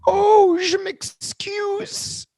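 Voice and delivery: very high, slow French apology; French mock apology. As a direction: trill a fake French sorry.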